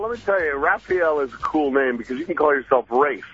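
ordinary talk, nothing marked

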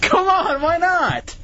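high, pleading come on; pleading whine. Real, not acted. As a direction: coax him, voice up high. Host pleading with a cohost.

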